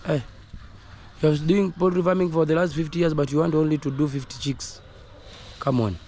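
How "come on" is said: normal talking voice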